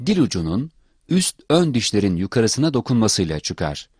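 normal talking voice

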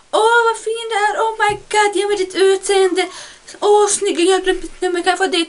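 high, sing-song fake adoring gush; hammed-up squealing compliments; mock gushing commenter. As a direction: gush like a fake-sweet fan.

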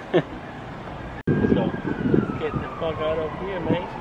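normal talking voice

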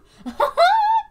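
high squealing burst of laughter; shrieking laugh. Real, not acted. Fan laughing over her idol result, shrieking giggle.

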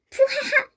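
high burst of acted laughter; mocking laugh. 어설픈 숨김을 보고 웃음. (높은 소리로 푸하하 비웃기)

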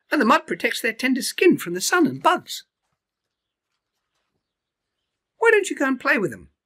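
high, bright cheerful bird voice; a friendly bird cheering him on; bird Iris. Acted.

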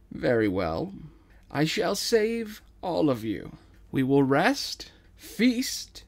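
slow, loud grand promise; regal king. Acted. King vowing to save his people, grand and regal. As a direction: promise it like a benevolent monarch. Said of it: "King gives a slight bow".

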